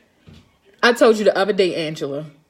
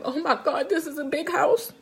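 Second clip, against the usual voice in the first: tearful wail; 6 semitones higher than usual. Retold or imitated. Crying in the car over a client's house, mock-tearful wail. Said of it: "I was crying just like that".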